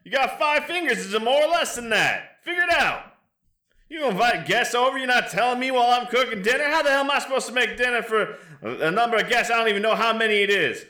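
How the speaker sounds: scolding wife. Acted. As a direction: tear into him while cooking. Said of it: "she just calls back".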